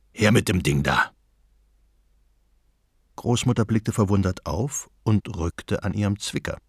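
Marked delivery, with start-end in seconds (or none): 0.1-1.1 gruff robber shout. A narrator's reading. Robber demands grandmother's grinder, gruff low bark. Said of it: "eine barsche Stimme rief".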